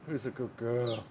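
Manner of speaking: soft, hushed pet talk; cooing softly. Real, not acted. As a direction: coo it gently to the pet. Talking to pet, softer take.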